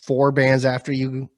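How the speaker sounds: ordinary delivery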